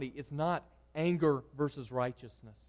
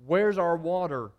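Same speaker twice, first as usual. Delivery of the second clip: mock angry demand; 6 dB louder than usual. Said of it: "the people are threatening him".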